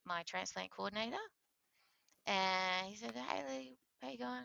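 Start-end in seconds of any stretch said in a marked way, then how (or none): 3.2-4.4 high, easy phone greeting; imitating a coordinator's offhand hello; breezy mock-casual caller. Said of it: "Like, so casual".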